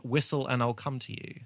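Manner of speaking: ordinary delivery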